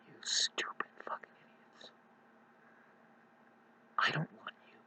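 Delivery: hissed whisper insult. Real, not acted. Vlogger whispers insults to newcomers.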